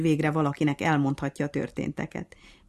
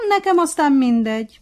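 smug; 10 semitones higher, 11 dB louder than usual. A narrator's reading. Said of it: "mondta fölényes mosollyal a majom".

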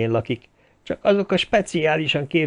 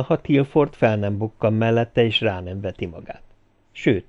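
plain reading voice

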